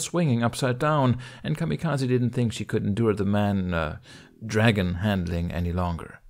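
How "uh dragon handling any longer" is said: ordinary delivery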